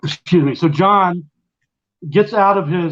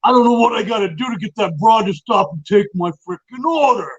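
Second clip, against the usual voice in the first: higher-pitched imitation of a brash New York loudmouth; John, no filter; 4 semitones higher than usual. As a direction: gripe like a mock mobster with no manners. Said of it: "John with his no filter".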